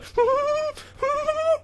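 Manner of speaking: very high wordless squeal; high-pitched mock freak-out noises; imitated excited squealing. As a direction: mimic someone too excited to get words out. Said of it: "making all these weird noises and grunts".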